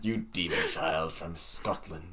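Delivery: loud mock Scottish accent, breaking into laughter; hammed-up Scottish accent, half whispered then laughing. Teasing a friend in a Scottish accent, loud. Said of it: "bring out the Scottish accent".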